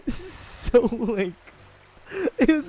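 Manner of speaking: high and loud through laughter; laughing. Real, not acted. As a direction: laugh while trying to speak. Laughing at cringe trailer.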